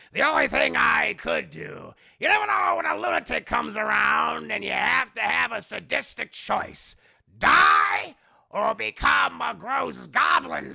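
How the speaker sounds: loud, slow theatrical villain rant; Willem Dafoe. Acted. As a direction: declaim it like a deranged goblin. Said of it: "the Dafoe voice because I like it better".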